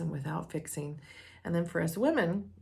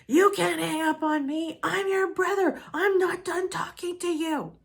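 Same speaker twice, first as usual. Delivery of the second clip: much higher and louder, outraged brother; furious brother imitation; 12 semitones higher, 7 dB louder than usual. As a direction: play the brother shouting that she can't hang up. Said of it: "This just triggered him and made it even worse".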